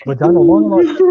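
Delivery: high, loud disbelief at the title; mock shock at the movie title; incredulous. Real, not acted. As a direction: say the title as if it's absurd.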